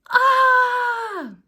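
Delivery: high, loud shriek of terror; scream. Acted. Reader screams as Ned would, high and loud. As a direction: scream the house down. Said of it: "make him scream the house down".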